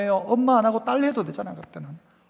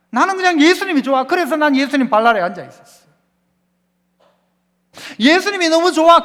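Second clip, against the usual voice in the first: gushing Mary; 6 semitones higher, 5 dB louder than usual. Acted. Pastor voicing Mary's simple joy at Jesus' feet, high and gushing. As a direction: voice lifted high and louder with adoration. Mary's heart voiced with adoration.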